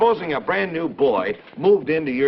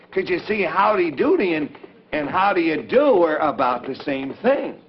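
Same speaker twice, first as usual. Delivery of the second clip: ordinary talk, nothing marked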